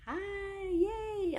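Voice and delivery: very high, drawn-out squeal of welcome; delighted high-pitched hello; excited greeting. Real, not acted. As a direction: cheer hello in a high, long-held voice. Excitedly greeting arriving participants.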